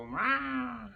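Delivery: high parrot mwah; high squeaky mwah; imitating parrot. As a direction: mimic the bird's kiss. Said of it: "so he just go".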